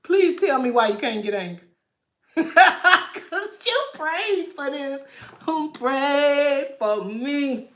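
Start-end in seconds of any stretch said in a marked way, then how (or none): none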